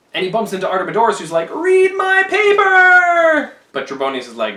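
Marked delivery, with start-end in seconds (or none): 1.5-3.5 much higher, very loud, slow mock plea; desperate shout. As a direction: wave the note and yell for attention. Artemidorus desperate to warn Caesar.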